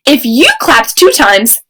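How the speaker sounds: normal talking voice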